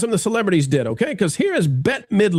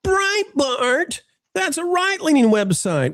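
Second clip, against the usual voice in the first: mocking viewer voice; 11 semitones higher, slower than usual. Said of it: "some of you out there are gonna say".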